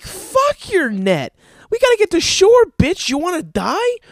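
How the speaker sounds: high, loud acted yelling at a friend; angry shouting. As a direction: scream urgently over the danger. Acted shouting match at sea.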